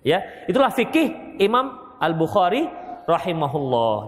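usual speaking voice

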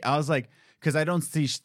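usual speaking voice

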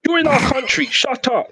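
high-pitched, put-on indignation; squeaky-high pretend telling-off; mock indignant retort. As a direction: pitch it right up in mock outrage. Acted retort to an imagined American.

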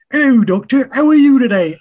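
loud, high West Country greeting; actor's mistaken West Country voice, pitched high and loud; West Country accent. Retold or imitated. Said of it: "Oh, God, no, not West Country".

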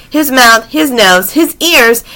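ordinary delivery, nothing marked

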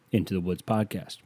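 ordinary talk

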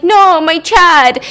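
loud, slow, drawn-out cry for a friend; mock despair, slow and loud; mock anguished cry. Chad two crying out to fallen Chad one, loud mock anguish.

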